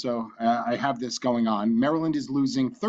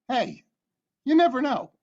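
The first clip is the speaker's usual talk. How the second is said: put-on Italian accent; 11 semitones higher than usual. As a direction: ham up an Italian New York Lotto voice. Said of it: "in a very good Italian accent".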